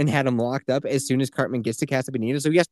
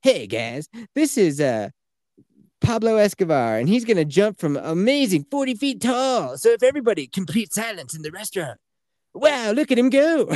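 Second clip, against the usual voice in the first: mock PA announcer, pitched up; hammed-up announcer; 7 semitones higher than usual. The restaurant announcer hyping the cliff diver, mock showman. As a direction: hype the cliff diver like a lightly mocked showman. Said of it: "I heard they were like".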